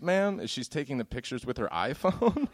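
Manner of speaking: ordinary delivery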